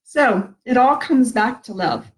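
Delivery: ordinary talk, nothing marked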